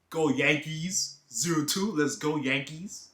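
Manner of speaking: slow, drawn-out cheer for the Yankees; team cheer. Pretend. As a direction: stretch it out like a fan chant. Cheering for the New York team.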